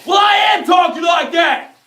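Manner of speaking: loud, high defiant yell; angry high shout at critics. Real, not acted.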